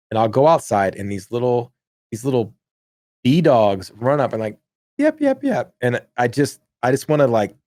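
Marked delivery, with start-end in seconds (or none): 5.0-5.7 high squeaky mock yapping; yappy dog bark. Friend imitating neighbor's yappy dog barking. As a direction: yap like a tiny dog.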